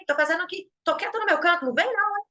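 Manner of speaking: mock angry comeback; snapping back. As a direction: hit back in a huff. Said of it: "um impulso é revidar de volta".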